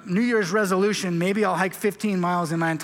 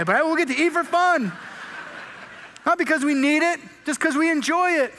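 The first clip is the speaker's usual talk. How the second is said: excited; 6 semitones higher than usual. Real, not acted. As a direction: sell the fun of feasting. Excited about eating in heaven.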